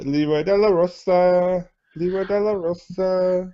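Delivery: high, loud ring announcement; booming wrestler boasts. Retold or imitated. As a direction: boom out the wrestler name. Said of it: "I don't have a voice for Chad Santana".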